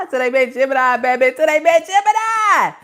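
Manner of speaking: loud, high, laughing through the words. Real, not acted. Cracking up, repeats the punchline.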